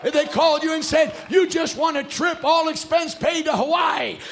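high, loud sermon climax; rousing preaching. Real, not acted. Preacher announcing salvation as the grand prize, rousing preaching. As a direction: build to a shouted proclamation.

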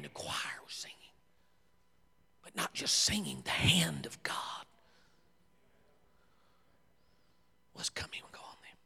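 a reverent preacher's whisper; hushed whisper. Acted. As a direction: breathe it like a secret in church. Hushed telling of the spirit.

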